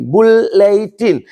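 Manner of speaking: बुलेटिन slowly as बुल-ले-टिन, three separate parts; split into syllables. Shown as a demonstration. Teacher showing students the three syllables, slow and separated. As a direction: stretch out बुल... ले... टिन, each distinct. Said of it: "बुल अलग है".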